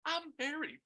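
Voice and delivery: tiny, high, soft voice; small high-pitched mock female voice; imitated woman's interjection.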